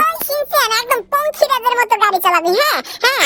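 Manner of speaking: put-on very high, bratty child voice calling out; squeaky little brat yelling for his friend; cheeky cartoon kid. Kid calling for Doraemon, high squeaky voice.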